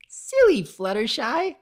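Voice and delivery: high, loud patronizing sing-song. A narrator's reading. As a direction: talk down to her sweetly. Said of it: "she said patronizingly".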